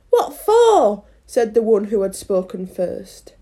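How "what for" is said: high, loud acted demand; indignant query. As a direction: fire it back in affronted disbelief. Gardener demands a reason.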